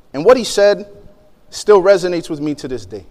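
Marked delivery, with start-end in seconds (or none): none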